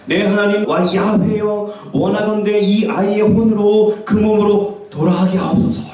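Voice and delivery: high, drawn-out pleading prayer; Elijah's prayer. Acted. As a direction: chant it slowly up to God. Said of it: "이렇게 기도하거든".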